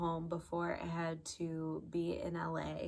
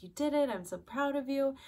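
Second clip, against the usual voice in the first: imitating a proud trainer, higher and louder; proud congratulating; 7 semitones higher, 5 dB louder than usual. Trainer hugging her new graduate after she passed, proud and gushing. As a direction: gush congratulations, raised pitch.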